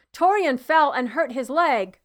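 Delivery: loud, pressing appeal for help; urgent plea. A narrator's reading. Father begs the healer, loud and urgent. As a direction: beg the healer to help your boy. Father pleading for his son.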